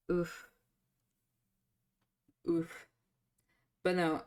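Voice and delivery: usual speaking voice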